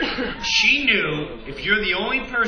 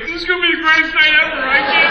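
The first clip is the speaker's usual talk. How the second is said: excited friend; 8 semitones higher, 8 dB louder, much faster than usual. Retold or imitated. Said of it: "my little friend's like".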